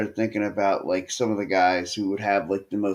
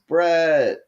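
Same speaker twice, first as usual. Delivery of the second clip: mock yell, pitched higher; retold yell; 6 semitones higher, 4 dB louder than usual. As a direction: call out the name as a kid would. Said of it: "he yells".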